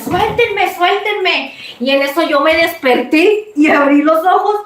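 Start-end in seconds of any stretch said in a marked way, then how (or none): 0.0-1.5 high, pleading cry to be let go; forced pleading voice; desperate plea. Retold or imitated. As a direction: beg them to release you, strained. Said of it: "yo empecé a decir".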